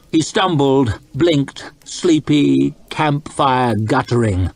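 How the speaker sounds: usual speaking voice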